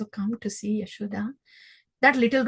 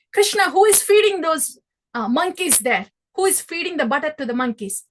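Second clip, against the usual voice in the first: louder, higher stern questioning; scolding mother; 5 semitones higher, 6 dB louder than usual. Acted. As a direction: demand answers like an exasperated mother. Yashoda interrogating Krishna.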